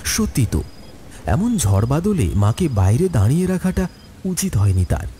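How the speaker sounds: plain reading voice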